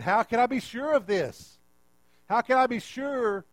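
high, loud, insistent questioning; acted-out challenge; doubtful demand. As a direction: press for certainty. Acting Zechariah demanding a sign.

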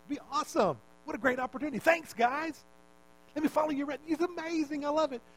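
high-pitched, put-on gushing enthusiasm; gushing fan. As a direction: gush like an over-eager hanger-on. Acting out Simon as eager follower.

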